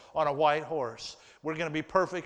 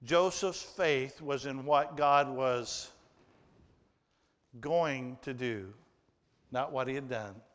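ordinary delivery

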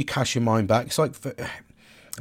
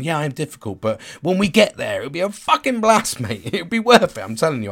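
higher and louder, building to a laughing brag; cheeky excited boast; 4 semitones higher, 4 dB louder than usual. Retold or imitated. Talking to friends about his quirks, a playful laughing boast. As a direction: sell the fun night out with a grin and a laugh. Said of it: "I like to pride myself on".

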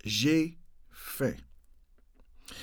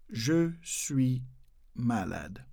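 "Je suis malade" slowly, pausing between words; soft, spaced-out "Je", "suis", "malade"; 4 semitones lower, 6 dB quieter, much slower than usual. Shown as a demonstration. Teacher breaking down the French phrase for learners. Slow broken-down repeat for learners.

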